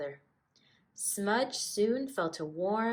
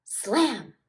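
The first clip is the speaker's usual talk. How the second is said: slam; 5 semitones higher, 9 dB louder than usual. A narrator's reading. Door slams as Smudge leaves.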